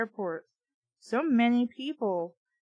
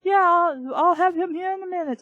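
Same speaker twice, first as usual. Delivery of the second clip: put-on much higher and louder, a shouted Egyptian accent; bad Egyptian accent; 7 semitones higher, 6 dB louder than usual. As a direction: play a loud, hammed-up Egyptian phone worker. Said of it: "my really shitty Egyptian".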